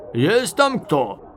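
drawn-out loud call; hailing shout. A narrator's reading. Enemy officer checking who is inside, shouting. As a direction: call out slowly through a door. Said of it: "раздался голос с сильным канадским акцентом".

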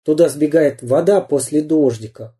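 ordinary delivery

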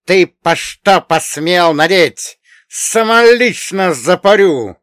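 high, loud, drawn-out outrage; furious, high-pitched threat; angry hissed threat. A narrator's reading. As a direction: threaten loudly in a high, slow voice. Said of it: "зашипела она".

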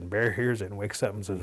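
usual speaking voice

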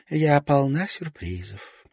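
flirty. A narrator's reading. Frankie flirting, licking lips.

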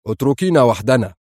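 sharp, controlled order; curt command to leave. A narrator's reading. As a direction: dismiss her sharply. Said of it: "يقول في حدة".

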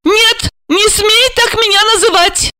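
very high indignant voice; hysterical protest. A narrator's reading. Wife refusing husband's endearment, hysterical protest. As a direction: forbid it in a high-pitched outburst.

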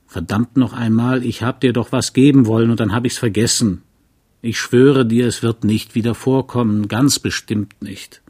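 usual speaking voice

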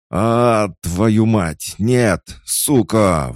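very high, loud screamed curses; sudden screaming. A narrator's reading. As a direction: scream and curse in sheer terror. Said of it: "внезапно закричал Фома".

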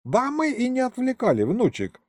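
put-on high-pitched, amused old man; chuckling grandpa. A narrator's reading. Grandpa to his grandson about the Englishman, high and amused. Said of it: "хмыкнул дед".

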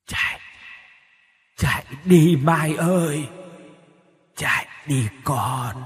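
a dead mother's voice echoing from far away, drawn out slowly; distant ghostly voice. A narrator's reading. Ghost mother urging her daughter Mai to flee, distant echoing voice. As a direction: call out slow and hollow, as if from beyond the grave. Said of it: "tiếng nói như từ nơi xa xăm nào đó vọng tới".

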